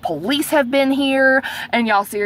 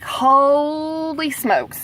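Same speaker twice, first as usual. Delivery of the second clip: very drawn-out, higher-pitched exclamation; dismayed amazement; 4 semitones higher, much slower than usual. Retold or imitated. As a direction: stretch HOLY out in dismay. Retelling her dread on hearing news.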